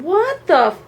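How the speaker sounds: high, incredulous retold protest; acted-out indignant astonishment; outraged disbelief. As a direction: stretch it out, rising in disbelief.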